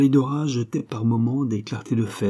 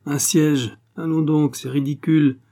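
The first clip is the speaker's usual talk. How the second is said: scornful; 5 semitones higher, 4 dB louder than usual. A narrator's reading. René scoffing at siege idea.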